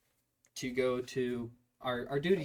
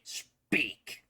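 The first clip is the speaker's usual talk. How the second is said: usual speaking voice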